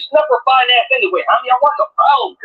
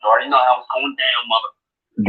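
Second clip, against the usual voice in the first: much lower, quieter, ominous; mock menacing; 14 semitones lower, 5 dB quieter than usual. Player growling a low villain line as they leave. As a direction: drop the voice low like a movie villain.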